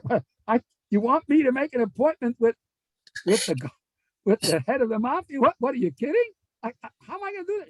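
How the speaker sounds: flustered protest, rushing and squeaking up; panicked disbelief. Retold or imitated. Man asked to book the mafia boss, panicking.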